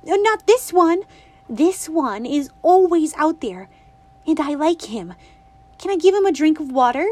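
put-on a bright little girl asking her granny; girlish and eager, lightly voiced; Winnie's voice. A narrator's reading. Winnie pleading for the toad.

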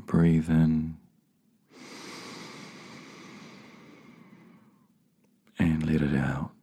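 slow, measured meditation guide with long pauses; spacious, calming delivery, letting each phrase settle; guided meditation. Real, not acted. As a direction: lead them gently inward, unhurried.

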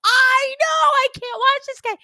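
agreeing with her friend about the runner, high and loud; exasperated agreement. Retold or imitated. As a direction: squeal it like you can't bear to watch. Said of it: "I'm like".

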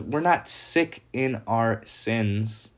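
half-speed solemn quote of We're not sick in our sins. We are dead; solemn scripture quote. Shown as a demonstration. Preacher quoting Ephesians to the congregation, slow and solemn. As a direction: deliver the verse slowly, each word weighted. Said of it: "Ephesians two says".

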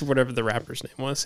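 usual speaking voice

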